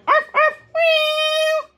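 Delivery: high yaps then a meow; bark, bark, then meow like the pets. Acted. Puppy and kitten call.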